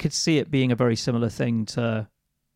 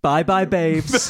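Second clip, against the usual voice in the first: slow, high, laughing farewell; laughing goodbye; 5 semitones higher, 4 dB louder, slower than usual. Acted. Slow, high, laughing goodbye. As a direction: Taryn bids her captain goodbye with a slow, high laugh. Taryn bids captain goodbye.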